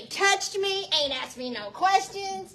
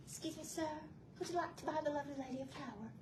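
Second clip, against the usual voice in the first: put-on much softer, quick polite flower-girl ask; softer rushed polite ask; sweet sales pitch; 19 dB quieter, faster than usual. Shown as a demonstration. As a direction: murmur the pitch sweetly to a stranger. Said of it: "my job was to go into these honky tonks and say".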